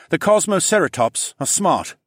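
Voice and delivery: lightly robotic, high-pitched engineer; a hint of exhausted automaton; almost robotic. A narrator's reading. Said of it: "His voice sounded almost robotic".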